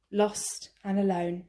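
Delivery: usual speaking voice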